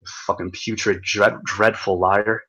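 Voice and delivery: normal talking voice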